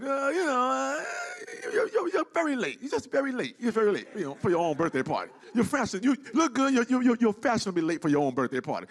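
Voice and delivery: stammering polite scold; a light Larry impression; imitating Larry.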